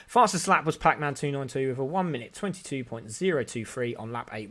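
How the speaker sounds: normal talking voice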